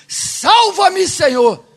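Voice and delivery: loud cry for rescue, high at the start; grito. Acted. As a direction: shout it out as a desperate plea. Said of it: "ele gritou". (grito de socorro, alto)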